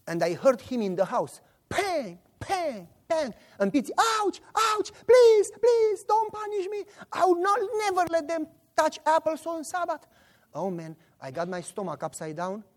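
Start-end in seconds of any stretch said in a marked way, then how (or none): 1.7-3.3 high-pitched spoken smacking noises; imitated belt blows. Storyteller imitating a punishment heard in the house, spoken whacks. 4.0-10.1 high-pitched mock wailing plea; fake screaming. Son faking a beating for listeners outside, wailing. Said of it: "smiling and screaming".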